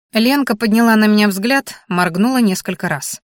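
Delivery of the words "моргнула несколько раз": usual speaking voice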